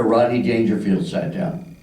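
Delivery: normal talking voice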